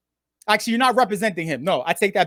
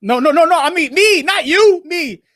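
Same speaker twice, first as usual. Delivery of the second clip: loud correction; 6 semitones higher, 5 dB louder than usual. Real, not acted. Corrects viewer, excited.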